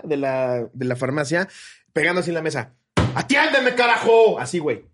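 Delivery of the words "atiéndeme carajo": high, loud, demanding yell; indignant shouted order, hammed-up; angry old man shouting. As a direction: imitate an irate customer yelling at the counter. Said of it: "se escuchaban sus gritos".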